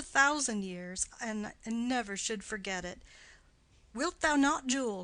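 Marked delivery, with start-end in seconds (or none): 3.9-5.0 put-on louder, playful husband teasing a toddler. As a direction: chuckle it to the little girl. Nurse voices husband again.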